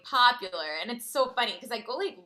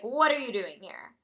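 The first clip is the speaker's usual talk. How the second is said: demanding, at a normal speaking level; demanding question. Retold or imitated. Narrator confronting this girl, demanding. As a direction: put the question out firmly. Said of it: "I was like".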